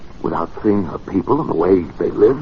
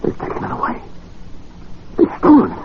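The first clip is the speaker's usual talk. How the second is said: shocked discovery. Acted. Discovering the machine stolen.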